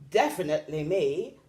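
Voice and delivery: lightly voiced zebra; laughing zebra. A narrator's reading. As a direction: say it with a mild laugh. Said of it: "laughed the zebra".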